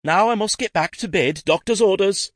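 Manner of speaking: high, loud, dramatic invalid's exclamation; theatrical cry. Acted. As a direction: overplay it like a diva on her sickbed. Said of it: "Jacqueline cried".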